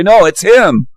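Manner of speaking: mock whiny accusation. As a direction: point the finger in a put-on high whine. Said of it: "They'll report to YouTube and say".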